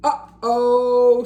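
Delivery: loud high worried uh-oh. Acted. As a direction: here comes trouble. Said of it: "said Splat".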